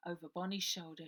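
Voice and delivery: ordinary delivery, nothing marked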